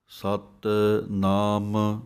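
ਸਤਿਨਾਮ slow, a small gap after ਸਤਿ; spaced pronunciation. Shown as a demonstration. Teaching students to space ਸਤਿਨਾਮ, slow. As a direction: separate ਸਤਿ from ਨਾਮ. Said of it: "ਥੋੜ੍ਹਾ ਜਿਹਾ space ਦੇ ਕੇ".

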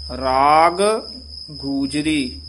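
both g sounds in ਰਾਗ ਗੂਜਰੀ. Shown as a demonstration. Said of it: "ਦੋ ਗੱਗੇ ਆ ਗਏ ਹਨ".

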